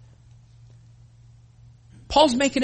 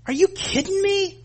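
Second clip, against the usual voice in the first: incredulous; 12 semitones higher than usual. Retold or imitated. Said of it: "I'm going".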